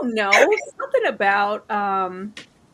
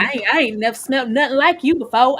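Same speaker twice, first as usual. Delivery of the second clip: fast, louder put-on New Orleans accent; imitating a Felix in a New Orleans accent; mock New Orleans accent; 3 semitones higher, 6 dB louder, faster than usual. Co-host quoting Felix sniffing out Lasher in a New Orleans accent. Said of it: "with his little one-off New Orleans accent".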